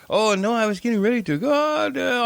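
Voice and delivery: high-pitched mock broken English. Dude in the lobby answering about food, broken English. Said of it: "all broken English".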